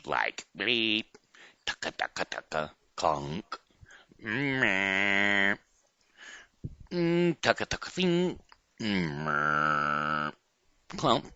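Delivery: beeps and clunks like a machine; robot noises. Shown as a demonstration. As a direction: make little robot sounds. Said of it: "will you make robot noises with me".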